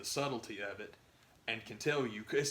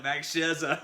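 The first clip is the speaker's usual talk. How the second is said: put-on Boston accent, "Max Scherzer" said with no R, as Schehzah, louder and higher; 5 semitones higher, 7 dB louder, slower than usual. Imitating the announcer's Boston accent slipping out, R dropped. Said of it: "totally dropped his R".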